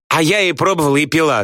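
high-pitched eager bragging; boastful. A narrator's reading. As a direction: brag like an excited girl. Люська хвастается выпивкой перед всеми. (хвастливо, высоким голосом)

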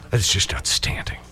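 breathy sarcastic aside, almost unvoiced; sarcastic whisper. Real, not acted. Host whispers a sarcastic aside to listeners. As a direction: mutter it under your breath, mocking.

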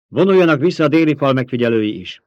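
loud order called over a storm. A narrator's reading. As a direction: shout the command over the storm's noise. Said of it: "túl kiabálni a vihar egyre fokozódó zaját".

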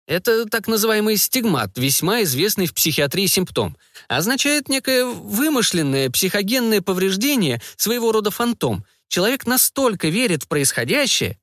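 high, pedantic researcher voice; lecturing scientist. A narrator's reading. Scientist explaining the stigmata, high and pedantic.

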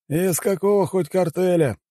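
slow, high-pitched chairman voice; председатель. A narrator's reading. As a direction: check just in case, drawling high. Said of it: "уточнил на всякий случай председатель". (высокий, тягучий голос председателя)

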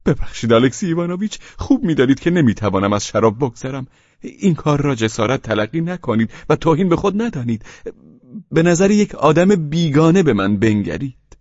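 a fawning guest begs a favour; nervous pleading; pleading Pavel Pavlovich. Acted.

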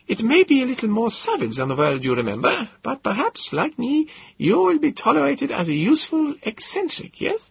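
put-on high, accented and musing; eccentric professor. Gödel muses to the narrator, high-pitched and accented. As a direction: muse in the odd professor's high voice.

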